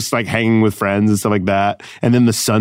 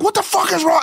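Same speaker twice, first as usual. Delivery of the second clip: very high exasperated outburst; retold snap, squealed in outrage; snapping. Performer rolling and fed up with security, snapping. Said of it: "then I'm, like, snapping".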